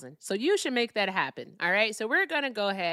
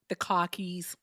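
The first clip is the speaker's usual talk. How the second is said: R-less Boston accent; slower than usual. Shown as a demonstration. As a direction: drop the R like a Bostonian. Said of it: "here's my Boston accent".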